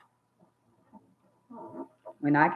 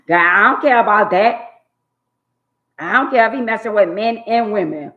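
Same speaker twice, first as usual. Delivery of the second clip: raised, louder voice on "I don't care"; exasperated outburst; 3 semitones higher, 6 dB louder than usual. Real, not acted. Ranting to her viewers, raised and exasperated. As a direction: throw up your hands and shout it. Ranting about cheating partners.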